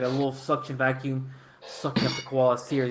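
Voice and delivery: ordinary talk, nothing marked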